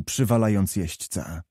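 ordinary delivery, nothing marked

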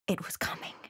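breathless whisper of dread; a frightened whisper. Acted. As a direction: hush it, almost no voice.

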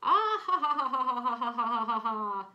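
long, loud staccato cackling from a high start; hammed-up uproarious laughter; mocking crowd laughter. A narrator's reading. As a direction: let a big acted laugh roll on and on. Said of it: "laughed all the other animals".

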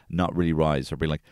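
usual speaking voice

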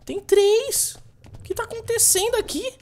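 high, loud startled cry; shocked. Real, not acted. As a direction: react in sudden disbelief. Suddenly sees more sharks.

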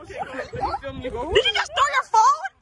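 high, slow, laughing disbelief; can't believe she threw her phone. Real, not acted. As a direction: gasp it out in laughing shock. Friend throws phone mid-swing.

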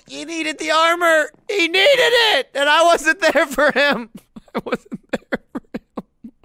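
high, loud mock wail breaking into a laugh; hammed-up sobbing. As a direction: overact the grief until it cracks into laughter. Mock grief over dead Alfredo.

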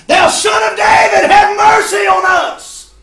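sudden high, full-throated shout. Acted. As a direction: bellow the plea, stretching the last words. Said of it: "They cried the louder".

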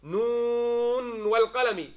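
slow, drawn-out chanted recitation; Quran recitation. Shown as a demonstration. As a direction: recite it slowly and melodically. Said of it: "Same thing in Surah Al-Qalam".

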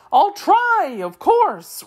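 high, loud, swooping agreement; eager. A narrator's reading. Wheeler accepting the machine man's offer, eager. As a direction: jump at it in a high creature voice. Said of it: "replied the Wheeler eagerly".

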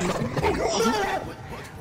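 high cry-laugh; squealing laugh. Real, not acted. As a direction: laugh until you cry. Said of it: "I'm crying".

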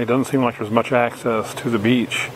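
usual speaking voice

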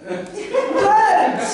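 Purge! called out high and bright; loud announcement. Acted. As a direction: proclaim Purge like an advert slogan.

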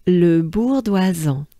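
"Le Bourdoisan" pronounced as one whole French name; French pronunciation. Shown as a demonstration. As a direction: model "Le Bourdoisan" for learners, French R and nasal ending. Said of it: "that's how it sounds".

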